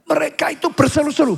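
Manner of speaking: high, urgent sermon delivery; impassioned preaching. Acted. Preacher tells congregation how God rescues Israel, impassioned preaching. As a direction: press each plea upward.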